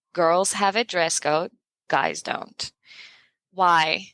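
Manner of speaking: ordinary talk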